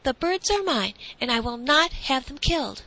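put-on high, firm boy's voice; stout declaration. A narrator's reading. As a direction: stand firm as the boy. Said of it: "declared the boy stoutly".

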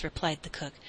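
usual speaking voice

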